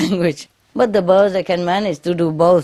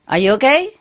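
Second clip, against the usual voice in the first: high, lightly voiced parrot asking; a hint of a bird checking on someone; 8 semitones higher than usual. Teacher mimics her parrot asking itself a question. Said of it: "they ask themselves".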